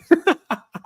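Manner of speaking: very high squeal of laughter. Real, not acted. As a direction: burst out laughing at the name. Streamer laughing at chat.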